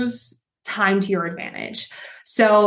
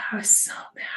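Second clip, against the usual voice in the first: whispered repeat, no voice at all; hushed whisper, still fuming; 6 dB quieter, faster than usual. Real, not acted. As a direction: breathe it out as an aside.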